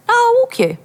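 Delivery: very high, insistent offer; acted pushy insisting; imitated insistence. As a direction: brush off the refusal, pitched right up. Dealer insists after refusal.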